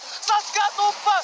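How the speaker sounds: high, drawn-out caster yell; hype scream. Real, not acted. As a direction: stretch every syllable in a shout. (teriakan komentator)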